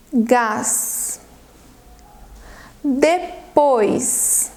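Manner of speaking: each word drawn out, long pauses between; careful, stretched-out spelling-test delivery; very slow dictation. Shown as a demonstration. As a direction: dictate slowly, every word twice. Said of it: "bem devagarzinho, vou repetir duas vezes".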